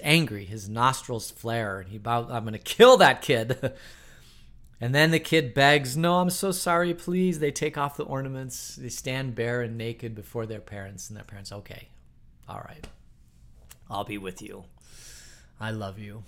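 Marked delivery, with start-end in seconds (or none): 2.3-3.7 loud mock threat from a fed-up parent; mock angry parent. Parent in the story yelling about the kid, loud mock anger. 6.0-7.4 put-on higher, pleading child voice; begging kid. Kid in the story begging the parent, higher pleading. 11.5-16.2 much softer, gentle forgiving voice; softened parent. Retold or imitated. Parent in the story forgiving the kid, soft and gentle.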